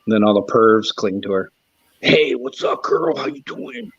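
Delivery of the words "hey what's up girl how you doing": high, sleazy pervert greeting; mock-creep calling out to a girl; creepy guy voice. Streamer mocking creepy chatters with a high sleazy hello.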